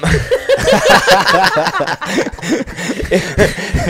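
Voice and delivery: bursting out laughing; big helpless laugh; loud laughter. Real, not acted. Laughing at getting fired.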